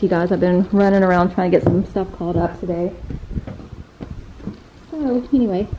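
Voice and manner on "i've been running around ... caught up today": ordinary talk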